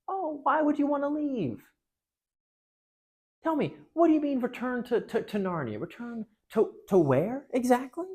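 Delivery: high, silky, mocking queen; sly witch. The queen coaxing the heroes, silky and high. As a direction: feign innocent puzzlement.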